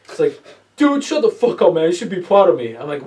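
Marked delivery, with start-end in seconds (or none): 0.8-2.8 higher, louder retort; a playful friend hollering back; mock indignant yell. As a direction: shout him down, half joking. Friend snapping back in the story.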